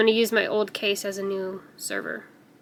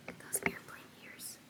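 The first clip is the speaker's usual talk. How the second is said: quiet whisper; 15 dB quieter than usual. Real, not acted. Whispering to viewers about the angry cat's ears. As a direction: barely voice it.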